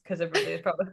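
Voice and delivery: ordinary delivery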